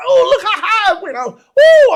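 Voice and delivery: very high, gleeful exclamation; excited kid. Retold or imitated. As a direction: whoop it up like an amazed child. Child awed by flying helicopter.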